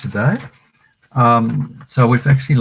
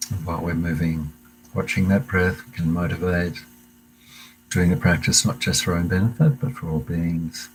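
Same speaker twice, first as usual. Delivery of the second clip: calm meditation guide voice, unhurried pauses; guided meditation; 3 semitones lower, 5 dB quieter than usual. Acted. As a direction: lead the class gently into the breath. Leads a breathing meditation.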